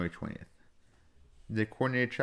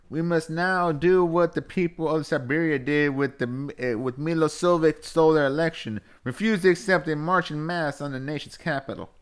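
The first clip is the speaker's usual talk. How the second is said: raised-pitch Southern speechifying; Southern accent; 6 semitones higher, 3 dB louder than usual. Acted. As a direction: deliver a call to march in a high Southern accent. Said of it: "I was just doing Southern".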